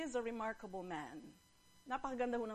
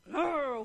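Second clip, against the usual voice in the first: loud imitated lion roar; storyteller's big "rawr"; lion growl; 3 semitones higher, 7 dB louder than usual. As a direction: growl hard before speaking. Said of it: "growled again".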